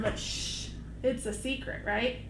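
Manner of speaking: soft hushed secret. Pretend. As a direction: whisper it like a secret. Stage whisper about secret.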